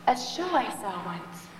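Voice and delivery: ordinary talk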